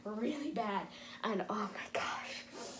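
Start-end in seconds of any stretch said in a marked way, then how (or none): 1.2-2.7 whisper. Real, not acted. Overwhelmed, whispering.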